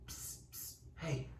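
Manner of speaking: hushed, sneaky attention-getter; whispered hiss, then a very soft call; secretive psst. Acted. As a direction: get their attention on the sly. Enemy voice secretly gets runner's attention.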